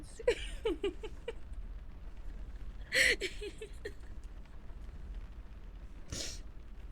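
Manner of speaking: can't stop laughing, airy; real helpless wheeze; high breathless laugh. Real, not acted. Laughing at Crocs edit.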